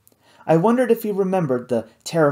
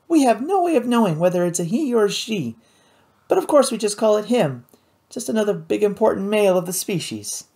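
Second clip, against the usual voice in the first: lightly voiced young woman, higher; higher, earnest girl's voice; 7 semitones higher than usual. A narrator's reading. Kate voiced in story.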